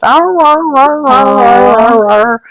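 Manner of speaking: high yowling imitation of a dog's song; high, playful dog-song arr sounds; dog singing. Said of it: "it just started singing".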